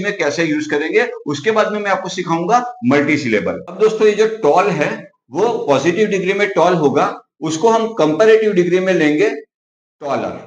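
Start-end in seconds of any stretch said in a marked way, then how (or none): none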